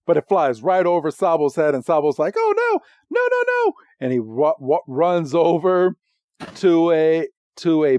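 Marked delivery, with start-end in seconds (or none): 2.4-3.7 very high squeaky mock panic; play-acted high-pitched alarm; Sobble panicking. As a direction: squeal it like a tiny frightened creature. Voicing panicked Sobble fleeing the ball.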